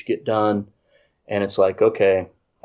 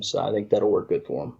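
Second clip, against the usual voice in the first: ordinary delivery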